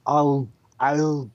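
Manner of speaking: put-on slow, deliberate Austrian Arnold accent; Arnold impression. Joking with friends, slow Austrian-accented Arnold. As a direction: drag out I'll be back like Arnold. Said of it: "Arnold Schwarzenegger is really fun to do".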